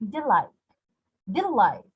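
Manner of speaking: "delight" with LIGHT strong, falling; weak de, strong LIGHT in "delight"; stress on second syllable. Shown as a demonstration. Teacher demonstrating an iambic word, second syllable stressed.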